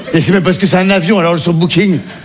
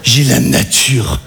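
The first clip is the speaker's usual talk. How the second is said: very slow, lower echo of mother's words; 6 semitones lower, much slower than usual. Retold or imitated. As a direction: drawl it back mockingly. Repeats mother's phrase slowly.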